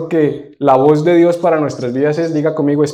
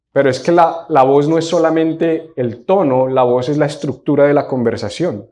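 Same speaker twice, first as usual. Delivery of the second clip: normal talking voice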